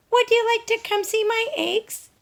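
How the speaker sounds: high, bright little hen voice; Dora the hen. Acted. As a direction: play a chirpy young hen wondering aloud. Dora asks Sally.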